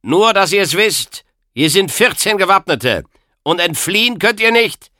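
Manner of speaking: sing-song confident gloating; smug and musical. A narrator's reading. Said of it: "musikalisch und selbstbewusst ... in gefälligem Ton".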